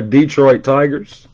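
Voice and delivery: ordinary talk, nothing marked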